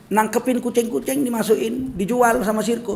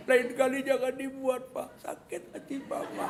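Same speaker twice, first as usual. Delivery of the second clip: soft, slow, hurt pleading; sulky wife; 5 semitones higher, 7 dB quieter than usual. Acted. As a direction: play a wounded wife scolding softly. Acted wife after husband's apology. (istri merajuk pelan)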